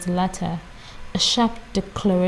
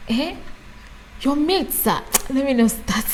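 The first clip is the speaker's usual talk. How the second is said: put-on higher-pitched African mother's accent; 6 semitones higher than usual. Mimicking mom on the listener's mates, African mother's accent. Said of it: "I can even say it in my African mother's accent".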